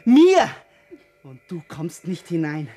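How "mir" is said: loud clipped insistence; emphatic shout. Acted. Man insisting to her that his life is his own. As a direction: bark the word.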